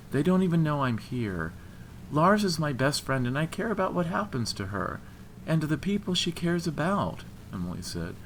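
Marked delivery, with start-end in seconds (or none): 0.1-7.2 higher-voiced, sincere insistence; earnest protest. A narrator's reading. Woman defending loyalty to her best friend, earnest protest. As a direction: protest sincerely, pitch lifted.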